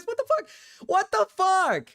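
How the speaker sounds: ordinary talk, nothing marked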